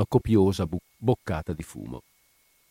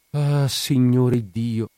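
long, slow, higher sighing exclamation; sighed lament; 4 semitones higher, 4 dB louder, much slower than usual. A narrator's reading. Nun at the sight of the wounds, sighing. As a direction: draw it out like a dismayed prayer. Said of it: "sospirò la suora".

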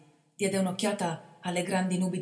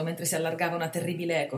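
ordinary delivery, nothing marked

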